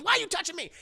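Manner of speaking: high-pitched mock outrage; retold indignant snap. Madea to the guy touching her, indignant.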